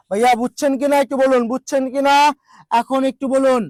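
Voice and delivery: normal talking voice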